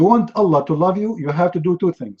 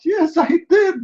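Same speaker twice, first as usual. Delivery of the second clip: very high mock-tearful cry; wailing confession; 13 semitones higher than usual. Accused yawner admitting the crime to the court, mock wailing. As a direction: confess in a hammed-up high wail.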